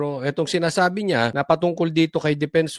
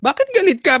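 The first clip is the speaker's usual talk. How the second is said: high, louder taunting question; mocking taunt; 13 semitones higher, 5 dB louder than usual. Host taunting the angry congressman, mock shocked.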